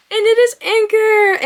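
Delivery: high, loud, slow announcement; grand delighted reveal; excited reveal. Real, not acted. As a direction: unveil it like a prize. Big reveal of octopus.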